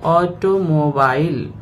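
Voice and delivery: "ऑटोमोबाइल" split au-to-mo-bail; syllable by syllable. Shown as a demonstration. Teacher showing learners four syllables, "ऑटोमोबाइल" spoken part by part. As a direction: sound out "ऑटोमोबाइल" in four separate beats. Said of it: "बाइल चौथा syllable हुआ".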